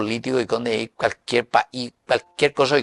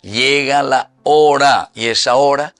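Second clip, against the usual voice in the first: scripture reading; 5 dB louder than usual. Acted. Reads the Bible verse aloud.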